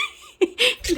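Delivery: a squeal of real laughter breaking out; high burst of laughter. Real, not acted. Owner laughing hard as her birds run from Sonny.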